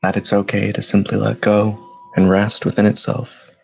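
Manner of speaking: steady, even meditation voice with long pauses; measured, level-toned meditation leader, unhurried between breaths; guided meditation. Acted. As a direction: guide them gently inward, phrase by phrase. Leads guided meditation after bell.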